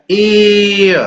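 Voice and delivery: ए drawn out slowly into one long ay sound; teacher demonstrating a long ए; long ay vowel. Shown as a demonstration. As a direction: hold the ए, stretching it long and clear. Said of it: "ए ऐसा long sound देना".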